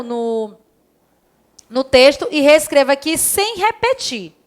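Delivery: usual speaking voice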